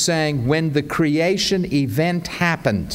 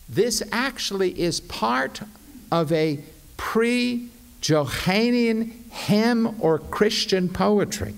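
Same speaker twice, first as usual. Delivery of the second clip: ordinary talk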